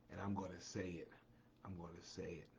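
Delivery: dropping to a hush. Acted. Host teasing a big announcement to viewers, hushed and low. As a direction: pull the voice back as if sharing a secret.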